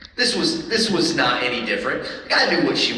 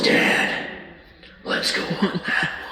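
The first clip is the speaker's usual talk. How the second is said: eerie savage daughter; 2 semitones higher, 3 dB quieter, slower than usual. Retold or imitated. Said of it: "and goes".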